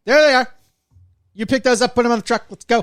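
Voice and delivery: loud, high bossy foreman voice; barking orders, hammed up. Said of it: "he's just like".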